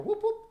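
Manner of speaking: high, loud whoop like a police siren; siren imitation. Mimicking the police siren at the door, high and loud. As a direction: whoop it out like a cop car.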